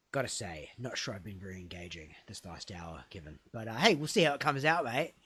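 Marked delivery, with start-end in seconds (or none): none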